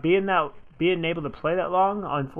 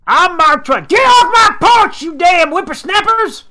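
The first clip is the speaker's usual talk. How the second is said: put-on grumpy old man yelling; high, loud cranky old-timer voice; old prospector; 13 dB louder than usual. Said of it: "He looked like a grumpy old dude".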